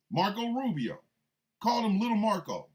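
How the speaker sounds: normal talking voice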